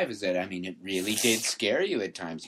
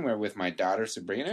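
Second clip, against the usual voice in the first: ordinary delivery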